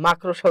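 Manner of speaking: মাকড়সা said as "mak-ro-sha", r shifted before the o; common mispronunciation. Shown as a demonstration. Teacher repeating the popular error for students, মাকড়সা mispronounced as "makrosha". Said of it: "এরকম উচ্চারণ করি".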